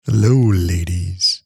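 slow, low ladies' man greeting; drawn-out seductive hello; lothario. Pretend.

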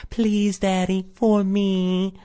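put-on high, sweet pleading little girl; innocent girl voice. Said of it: "puts on her best innocent girl voice".

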